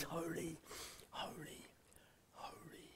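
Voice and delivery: "holy" sinking into an unvoiced whisper; fading to a whisper. Real, not acted. Preacher lost for words, whispering "holy" in awe. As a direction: let each "holy" fade into breath. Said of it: "I can't even find the word".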